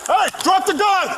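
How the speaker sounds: loud urgent command shout; shouted surrender demands. Real, not acted. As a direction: yell it at an armed man. Officer confronting armed man.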